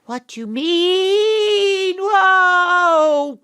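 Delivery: high, loud, slow cry; frightened whoa; alarmed cry. Acted. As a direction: scream as you're swept away. Whisked away again.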